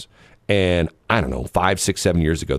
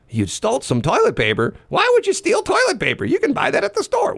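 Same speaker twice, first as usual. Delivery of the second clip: put-on much higher, disbelieving kid voice; incredulous kid. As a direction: squeal it in disbelief.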